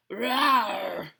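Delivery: loud imitated bear growl; bear roar. Old Bear follows his nose to the kitchen, roaring.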